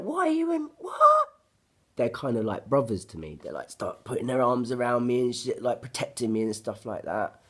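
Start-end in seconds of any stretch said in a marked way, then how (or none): 0.0-1.3 very high, loud disbelieving squeal; shrieking high surprise; shocked friend. Retold or imitated. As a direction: mimic a stunned friend at the news.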